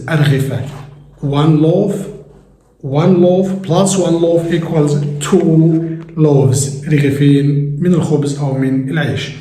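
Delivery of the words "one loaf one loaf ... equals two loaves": very slow, word-by-word sum ending on "loaves"; slow teaching demo. Shown as a demonstration. Teacher walking viewers through loaf to loaves, very slowly. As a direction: count it out slowly for learners, landing on "loaves". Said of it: "teacher spells out loaf to loaves".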